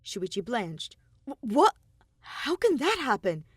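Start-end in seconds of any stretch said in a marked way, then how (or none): 1.2-3.4 higher and louder startled stammer; alarmed. Acted. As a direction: blurt it out in sudden alarm. Shuichi blanches at explosion.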